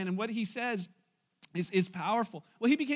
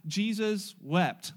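very slow, word by word; spaced out for others to repeat; teaching recitation; 3 semitones lower, 4 dB louder, much slower than usual. Shown as a demonstration. Preacher helping congregation memorize a verse, slow teaching recitation. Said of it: "I'm gonna help you this morning".

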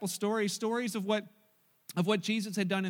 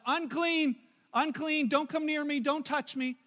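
higher, loud warning call; yelling warning; 6 semitones higher, 4 dB louder, slower than usual. A narrator's reading. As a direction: call out to keep people away. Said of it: "they had to yell out".

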